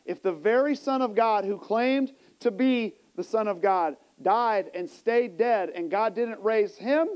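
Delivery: ordinary talk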